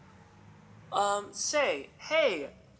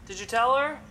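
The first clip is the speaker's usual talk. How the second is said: sharp loud question; 8 semitones higher, 9 dB louder than usual. Acted.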